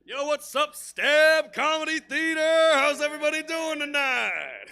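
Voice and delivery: high, very loud, drawn-out holler; shouted stage greeting. Real, not acted. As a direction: fire up the whole room. Comic greeting the crowd at start.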